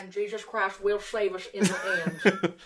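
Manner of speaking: soft mock Southern preacher. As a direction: intone it like a preacher. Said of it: "preacher voice".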